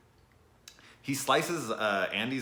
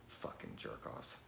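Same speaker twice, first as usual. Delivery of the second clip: whispered insult; 8 semitones lower, 15 dB quieter than usual. Real, not acted. Vlogger whispering a jab at the cocaine accusers. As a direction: whisper it, slow and bitter. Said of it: "I'm very angry tonight".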